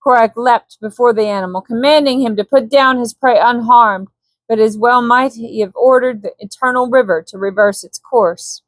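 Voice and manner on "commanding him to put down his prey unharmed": ordinary delivery, nothing marked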